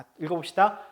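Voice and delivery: usual speaking voice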